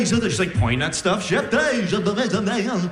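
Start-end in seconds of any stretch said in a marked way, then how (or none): 1.2-2.9 high, running possessed gibberish; speaking in tongues. Acted. Girlfriend points at things, high babble in tongues. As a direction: babble nonsense like an exorcism case. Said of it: "She started speaking in tongues".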